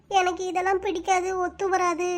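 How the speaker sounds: tearful refusal, breaking into sobs; weeping as she reports her answer; crying. Acted. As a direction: say it through tears. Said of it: "அழுகையை நிறுத்திட்டு சொல்டி".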